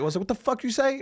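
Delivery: high-pitched mock challenge to a heckler; hammed-up "what did you say" at a heckler; mock confrontation. Comic recalls confronting a heckler.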